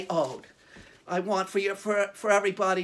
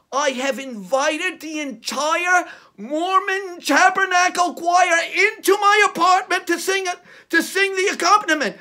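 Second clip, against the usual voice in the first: excited; 7 semitones higher, 6 dB louder than usual. Acted. Announcing the choir.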